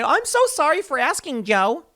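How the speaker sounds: high-pitched mock apology; exaggerated simpering apology; fawning journalist. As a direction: grovel sweetly to a touchy boss. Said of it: "they're playing with kid gloves".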